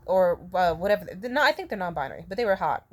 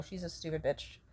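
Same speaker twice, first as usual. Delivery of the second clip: muttered insult; 4 semitones lower, 12 dB quieter than usual. Real, not acted. Muttered insult about sister.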